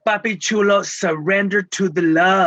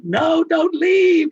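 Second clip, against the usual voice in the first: high, slow plea not to leave; begging like a seven-year-old; lightly voiced child's cry, drawn out; pleading child; 11 semitones higher, 4 dB louder, much slower than usual.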